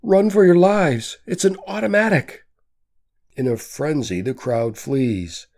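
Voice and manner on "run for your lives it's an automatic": high, loud panicked scream. A narrator's reading. A woman warns the crowd of a gunman, screaming. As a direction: shriek a warning in terror. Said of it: "screams a woman's voice".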